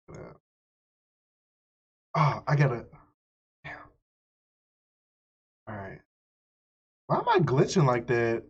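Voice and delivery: normal talking voice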